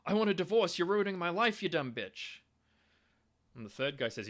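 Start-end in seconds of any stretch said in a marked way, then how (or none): 0.0-2.4 much higher and louder, snapping it out; angry outburst. Retold or imitated. Husband at breakfast yelling at his wife, angry outburst. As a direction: blurt it out like a furious husband. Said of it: "And instead I said".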